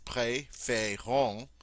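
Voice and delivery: préférons spoken slowly, each syllable clear, long A in the first two; slow syllable demo. Shown as a demonstration. Teacher slowly sounding out préférons for students. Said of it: "here we have three syllables".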